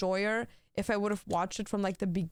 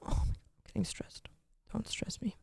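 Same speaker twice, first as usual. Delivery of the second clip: whispered under the breath; a whisper while stressed over missing Lego pieces; 5 dB quieter than usual. Acted. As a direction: whisper it to yourself, rattled.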